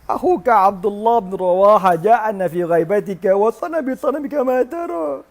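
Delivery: high, mock-crying recitation; tearful complaint. Wife tells husband what happened, tearful complaint. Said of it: "Menangis bini dia".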